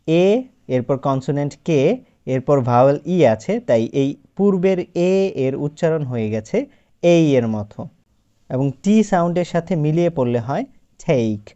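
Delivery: ordinary talk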